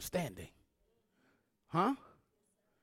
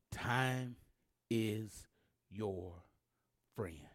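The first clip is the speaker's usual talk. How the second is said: time, is, your, friend, spaced and fading softer; word by word; 5 semitones lower, 8 dB quieter, much slower than usual. Shown as a demonstration. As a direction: drop each word separately, quieter each time.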